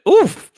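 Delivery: a loud, very high oof. Real, not acted. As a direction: wince out a sharp oof. Reacting to a harsh comment.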